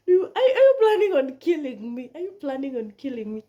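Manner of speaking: high, loud panicked question; retold panic, shrill rising then hurried; panicked cry. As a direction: blurt it out in alarm, then rush the repeat. Panicked quote to surgeon.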